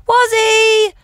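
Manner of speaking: high, singsong dog call; pet-calling voice. Shown as a demonstration. Owner calling her dog Warren, high pet accent. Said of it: "So when we call her, we're like".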